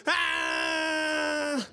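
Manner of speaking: long, high held wail; mock attention-seeking cry. Preacher imitating a child crying for attention, mock wail. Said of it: "a sudden attention-seeking crying".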